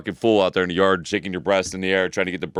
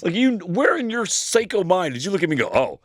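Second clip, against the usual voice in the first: incredulous; 9 semitones higher than usual. Retold or imitated. Retelling his incredulous reply.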